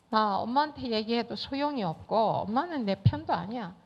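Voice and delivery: ordinary talk, nothing marked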